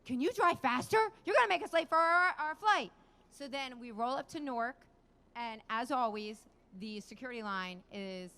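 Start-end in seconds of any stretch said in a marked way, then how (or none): 0.0-2.9 high, loud mock badgering; husband impression. Wife mimicking her husband in the car, loud nagging. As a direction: imitate an impatient husband hurrying the driver. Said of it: "Vinny will get in the car and be like".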